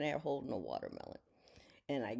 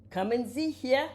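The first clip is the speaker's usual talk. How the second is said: high, slower, louder call; coaxing dog call; 10 semitones higher, 5 dB louder than usual. Real, not acted. Owner still calling the dogs over, coaxing call. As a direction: draw it out to summon the dogs.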